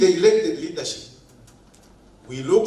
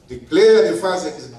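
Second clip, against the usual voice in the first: ordinary delivery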